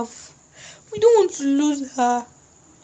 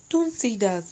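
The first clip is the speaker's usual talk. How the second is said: ordinary delivery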